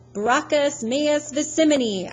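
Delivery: high, loud defiant Latin cry; yelling. Acted. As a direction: hurl the words as a battle cry. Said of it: "I yelled".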